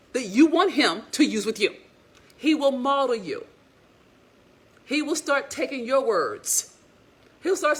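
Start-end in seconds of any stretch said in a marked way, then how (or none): none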